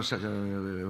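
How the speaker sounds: usual speaking voice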